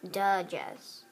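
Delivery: ordinary talk, nothing marked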